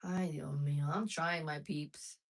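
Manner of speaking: usual speaking voice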